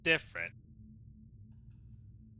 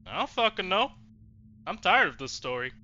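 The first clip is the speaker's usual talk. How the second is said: frustrated outburst; 7 semitones higher, 6 dB louder than usual. Real, not acted. Player frustrated with the game.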